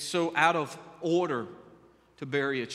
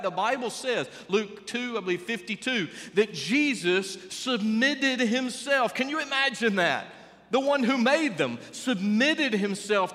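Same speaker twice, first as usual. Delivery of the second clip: preaching at full force; 5 semitones higher, 3 dB louder than usual. Acted. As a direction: lift the room as a preacher. Preaching builds to a peak.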